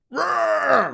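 high hammed-up roar; mock dragon roar. Dad as Clay roars to prove he is a dragon. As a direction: let out a silly dragon roar. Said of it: "I'm talking like a dragon".

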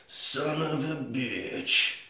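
very slow, soft drawn-out curse; mock sheriff, slowly cursing to himself; sheriff's muttered curse. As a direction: drag every word out under your breath. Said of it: "he just goes".

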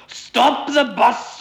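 high, loud, drawn-out wail; strangled cry. A narrator's reading. Mister Weasley coughs in the smoke, crying out. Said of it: "he cried".

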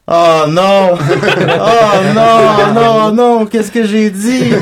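high, panicked mock wail with laughter; panicked imitation. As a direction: act out a laughing panic, slowing at the end. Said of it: "t'as fait".

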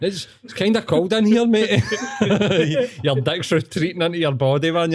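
high, laughing tease. Real, not acted. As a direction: giggle it out at your mate. Laughing while teasing friend.

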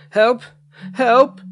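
high, loud cry for help; panicked shout. A narrator's reading. Boy stuck to the bench calls for help, shouting. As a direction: yell out for rescue. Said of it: "he shouted".

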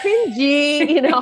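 high, loud, laughing cringe; mock teen disgust. Mom mimicking her boys' slang, laughing mock disgust. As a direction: squeal it like a grossed-out kid. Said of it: "that's what they say".

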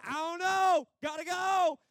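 very high, drawn-out cry; departing spirit calling back. Acted. Spirit answering the archangel while leaving, hurried high call. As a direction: call back as you get whisked away.

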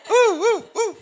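string of high, loud hoots; mock country whooping. Comic mocking God-fearing country, whooping.

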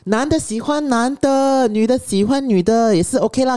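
high, slow, drawn-out grandma imitation; high sing-out voice, playfully imitating an elder; grandmother's loud commentary. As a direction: proclaim it like grandma making sure she's overheard. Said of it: "But she said it very loudly".

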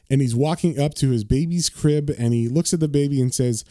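normal talking voice

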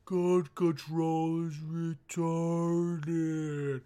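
very slow, drawn-out movie slow-mo; slow-motion movie line. Retold or imitated. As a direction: drag each syllable like slowed footage. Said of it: "in slow motion, you know how movies are like".

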